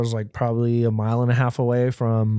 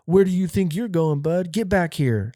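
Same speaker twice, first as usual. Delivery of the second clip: higher-pitched mock guard challenge; retold security guard; 6 semitones higher than usual. Guard stopping a teen shoplifter, mock challenge. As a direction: raise the pitch, imitating an officious guard. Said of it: "I remember him saying that".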